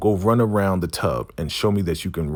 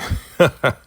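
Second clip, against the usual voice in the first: high, loud mock laugh; short burst of put-on laughter; imitated laughter; 8 semitones higher, 5 dB louder than usual. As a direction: imitate someone laughing at a newcomer. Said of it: "Malcolm is laughing".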